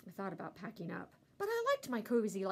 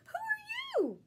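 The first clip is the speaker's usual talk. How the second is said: high dog voice; 4 dB louder, slower than usual. A narrator's reading. Dog character asks.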